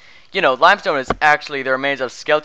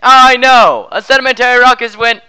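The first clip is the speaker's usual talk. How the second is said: loud infomercial pitch; shouting; 6 semitones higher, 8 dB louder than usual. Acted. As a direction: belt it out like an ad.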